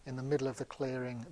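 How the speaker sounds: ordinary delivery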